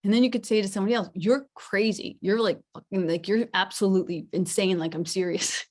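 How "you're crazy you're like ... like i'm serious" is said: level, forceful "you're crazy"; contrasting serious intonation. Shown as a demonstration. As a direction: say it in earnest at normal pitch, firmly. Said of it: "same words, but they sound very different".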